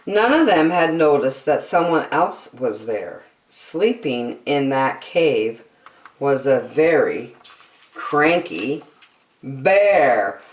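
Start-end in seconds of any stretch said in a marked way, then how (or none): none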